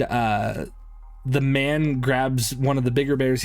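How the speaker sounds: normal talking voice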